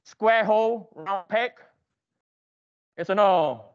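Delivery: ordinary talk, nothing marked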